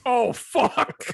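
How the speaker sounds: high, loud mock dismay. Woman in the men's room, loud high dismay. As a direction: realize the mistake too late. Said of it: "was like".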